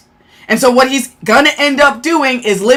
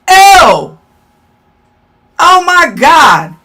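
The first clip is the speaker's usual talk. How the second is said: loud, higher grossed-out ew; disgusted ew; 3 semitones higher, 4 dB louder, much slower than usual. Real, not acted. Streamer reacting to a troll, loud disgusted ew. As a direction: recoil from something gross.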